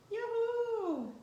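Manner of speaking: cri de joie aigu et chantant; lance-le comme une fête qui arrive; youhou joyeux. Real, not acted. Annonce joyeuse du catalogue de Noël. («Youhou!» lancé tout en haut, ravie)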